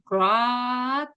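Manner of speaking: one drawn-out "crack"; "crack" held long as a single sound; long croak. Shown as a demonstration. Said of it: "el sonido largo".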